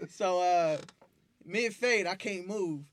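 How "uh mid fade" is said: ordinary talk, nothing marked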